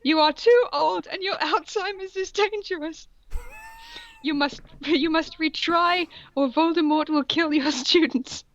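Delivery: high, stern schoolmistress voice; shrill scolding woman. Acted. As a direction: scold him in a prim high voice. Voicing Umbridge scolding Dumbledore.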